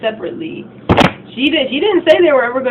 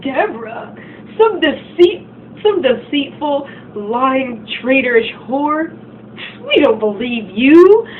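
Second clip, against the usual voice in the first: higher, louder scornful rant; acting out a scornful girl, higher and louder; insulting; 4 semitones higher, 3 dB louder than usual. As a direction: spit the insults at her.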